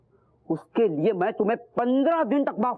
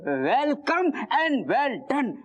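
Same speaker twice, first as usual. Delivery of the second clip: high, drawn-out, swooping congratulations; delighted welcome; 4 semitones higher, much slower than usual. Acted. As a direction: greet them grandly, stretching each word slowly. Delighted greeting for money bringer.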